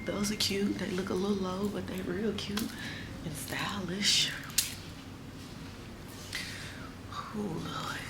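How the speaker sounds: hushed, breathy whisper in a quiet salon; real whisper. Real, not acted. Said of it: "it's kinda quiet in here".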